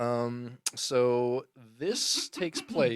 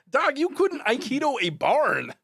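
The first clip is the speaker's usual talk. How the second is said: higher, louder, brash New York street voice; hyped, loud dude voice, pitched up; John Leguizamo impression; 13 semitones higher, 6 dB louder, slower than usual. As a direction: scoff at the director like a cocky young actor. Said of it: "John Leguizamo kinda talks like this".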